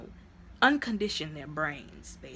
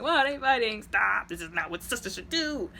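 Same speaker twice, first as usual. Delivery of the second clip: loud, high outburst easing by the end; exasperated protest; 4 semitones higher, 5 dB louder than usual. Retold or imitated. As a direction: scold the fighters in disbelief. Narrator protests at the sisters' fight.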